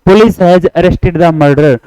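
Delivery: usual speaking voice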